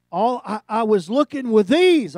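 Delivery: high, loud, slow anguish; anguished loud confession. Retold or imitated. Pastor confessing his blindness, loud and anguished. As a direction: plead it out slowly and loud.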